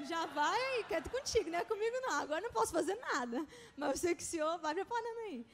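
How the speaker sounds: joking plea. Retold or imitated. Student praying for a job, joking laughing plea. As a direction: laugh through a lively mock plea. Jokingly replays her pleading prayer.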